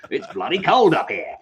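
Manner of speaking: hammed-up Aussie accent through laughter; joking Australian complaint. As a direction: play an Alaskan with an Australian accent. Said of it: "Alaskans have Australian accents".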